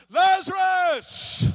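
huge, high, long-drawn shout; crying out. Acted. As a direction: call the dead man out, holding the name. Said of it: "Jesus cried out".

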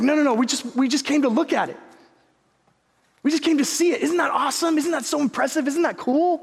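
high, insistent mock enthusiasm; dismissive dad. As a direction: imitate a dad overselling a letdown. Father brushes off son's expectation.

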